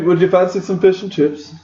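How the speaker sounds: British accent. Shown as a demonstration. Host trying out fish-and-chips Englishness, bad British accent. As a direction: have a poor go at a British accent. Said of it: "that was not very good".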